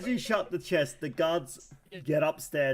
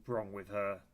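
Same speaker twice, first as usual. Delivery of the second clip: soft, lower mutter with halting pauses; muttering to himself; 6 semitones lower, 7 dB quieter than usual. Acted. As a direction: think aloud under the breath. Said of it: "the guard mutters to himself".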